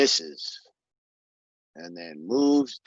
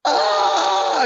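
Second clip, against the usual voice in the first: scream; 5 dB louder than usual. Acted. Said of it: "And then screams come".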